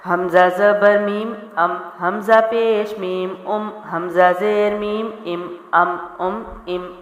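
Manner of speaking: slow chant of ام with a clear meem, not nasal; clear meem drill. Shown as a demonstration. Teacher drills a clear meem, slow chant. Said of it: "میم کو بھی واضح کرنا ہے".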